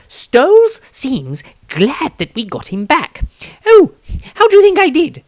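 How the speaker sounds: a high-pitched cowardly wizard voice; high eager wizard. A narrator's reading. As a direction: squeak it out like a nervous little wizard. Cowardly wizard Maestro speaks.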